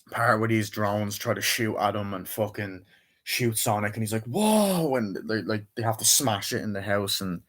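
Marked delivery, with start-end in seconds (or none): 4.2-4.9 high, drawn-out mock alarm; imitated startled cry. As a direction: play a cartoon hero caught by surprise. Acting out Sonic being shot at.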